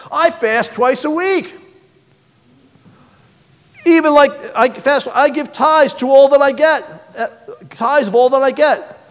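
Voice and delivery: a preening Pharisee lifting his voice to God; smug Pharisee. Acted. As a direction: pray as if showing off to God. Pharisee boasts of his piety.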